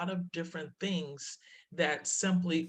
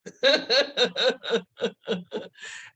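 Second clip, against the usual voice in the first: high, falling burst of laughter; long laugh; 6 semitones higher, 5 dB louder than usual. Real, not acted. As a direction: laugh at your own panic. Laughing after hanging up.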